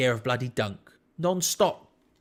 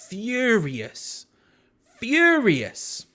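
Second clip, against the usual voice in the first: "furious" drawn out high and loud, then falling; emphatic "furious"; 8 semitones higher, 5 dB louder, much slower than usual. Real, not acted. Fan recalling his angriest day in football, emphatic. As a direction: hit "furious" hard, stretching the first syllable. Stressing how angry he was.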